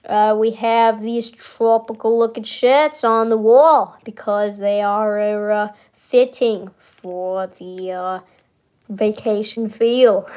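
slow mock Kennedy Boston drawl; Boston Kennedy accent, slow and drawn out; JFK impression. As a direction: keep the hammed-up JFK tour. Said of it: "talk like J F K for the rest of this tour".

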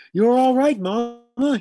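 high, loud reassuring shout; shouting to calm. A narrator's reading. Herman shouts to calm his mother, loud high. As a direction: yell the comfort over her panic. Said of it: "he shouted, trying to calm her".

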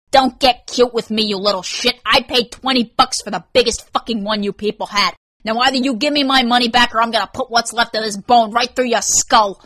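irate customer losing patience; angry threat. Acted. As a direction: threaten the clerk in a rage.

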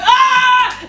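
very high, held squeal; high-pitched acted "ah"; mock fangirl scream. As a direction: re-enact screaming at your idol. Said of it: "I lost it".

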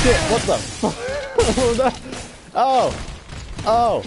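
loud, high panicked cries; panicked yelling. Real, not acted. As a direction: yell in real alarm. Boss fight ambush, panicked yelling.

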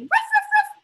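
high, loud puppy yips; excited barking. Shown as a demonstration. Teacher showing kids how the excited dog barks. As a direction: bark like a happy dog bursting out. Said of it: "because he's excited".

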